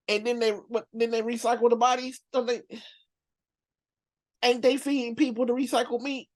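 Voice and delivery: high, disbelieving questions; aghast, high-pitched questioning; incredulous. Real, not acted. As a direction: keep the pitch up in sheer disbelief. Incredulous about recycled bodies.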